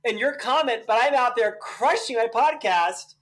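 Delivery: high, loud, fired-up comeback; defiant outburst. Real, not acted. Host answering his YouTube critics, loud and defiant. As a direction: throw it right back at the critics.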